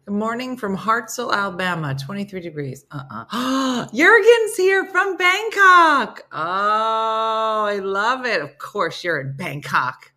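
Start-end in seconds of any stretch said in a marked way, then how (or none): 3.9-8.4 gasp, then a much higher, louder, slow squeal; thrilled to spot a favorite viewer; excited gasp. Real, not acted. Spotting a viewer from Bangkok in the chat, high excited squeal.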